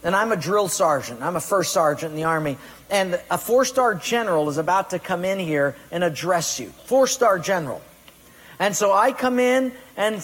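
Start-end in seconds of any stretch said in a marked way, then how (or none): none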